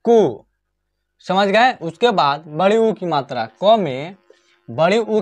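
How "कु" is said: कु with a quick short u; short u sound. Shown as a demonstration. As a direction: keep the u in कु brief. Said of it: "इसका sound भी कम आ रहा है". (कु, कम समय में छोटा उ)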